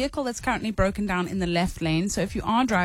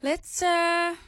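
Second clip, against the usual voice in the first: clue voice; 5 semitones higher than usual. Pretend. Radio co-host revealing a clue to listeners, clue voice. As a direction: put on a special clue-reveal voice. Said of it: "don't do the clue voice".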